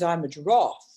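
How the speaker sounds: low, lofty giraffe voice; lightly voiced stately giraffe. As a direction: announce it grandly from a great height. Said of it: "the giraffe looks down and says".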